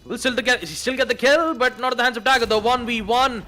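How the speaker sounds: loud, high play-by-play building to shout; esports caster. Real, not acted. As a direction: call the clutch, rising to a shout. Live play-by-play, clutch round.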